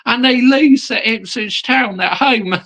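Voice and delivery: high-pitched incredulity; amused disbelief. Real, not acted. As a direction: pitch it way up, astonished at the absurdity. Ridiculing Tottenham's home defeat.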